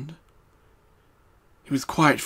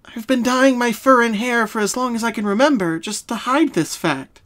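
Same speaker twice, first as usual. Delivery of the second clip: Pinkie's voice; 10 semitones higher, 5 dB louder than usual. Acted. Pinkie explaining her childhood trauma to Twilight, sad.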